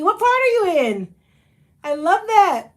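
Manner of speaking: ordinary talk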